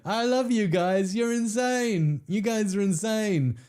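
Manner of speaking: high, slow, gushing praise; giddy gushing. Real, not acted. Streamer gushes at his chat, giddy and high. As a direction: gush over the audience.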